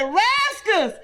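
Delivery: put-on high, slow shouted warning to a child; frantic mother calling her son; mama yelling. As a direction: yell at the boy to get off the track. Mother yelling at boy on tracks.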